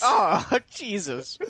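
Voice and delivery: laughing out oh Jesus; slow, cracking with laughter; laughing disbelief. Real, not acted. Reacts to the odd video title.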